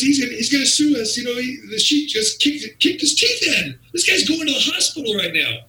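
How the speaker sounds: high, urgent mock panic; panicked. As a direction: imitate a frantic club owner pleading the problem. Club owner panics about lawsuit.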